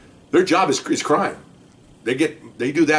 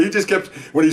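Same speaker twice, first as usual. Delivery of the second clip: ordinary delivery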